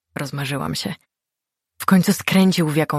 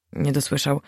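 ordinary delivery, nothing marked

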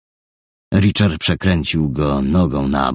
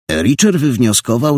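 ordinary delivery

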